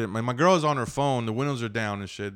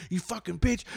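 high, held-back road-rage rant; mock yelling; 9 semitones higher than usual. Old driver cursing at him, mock yell. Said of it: "why he was fucking yelling at me".

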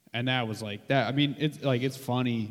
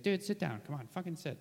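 lightly voiced impression, higher pitch; high, impatient urging, kept fairly soft; Bobby Kelly; 6 semitones higher, 6 dB quieter than usual. Comic retelling another comedian waving him over, high-pitched light impression. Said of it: "he's like".

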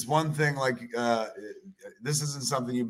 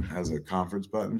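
ordinary talk

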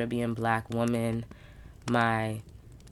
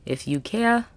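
ordinary delivery

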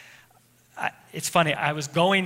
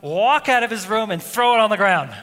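louder, higher heated retelling of the outburst; retold anger; 3 semitones higher, 4 dB louder than usual. Pastor retelling yanking his son's radio, heated and loud. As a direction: relive the angry rush, pushing the volume up. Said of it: "I was so angry".

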